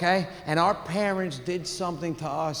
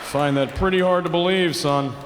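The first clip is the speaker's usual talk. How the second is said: skeptical cop. Retold or imitated. Skeptical cops taking a report.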